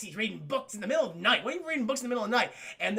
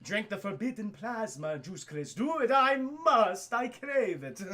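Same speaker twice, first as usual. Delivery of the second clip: hammed-up vampire; 2 semitones higher, slower than usual. Chat message read in vampire voice.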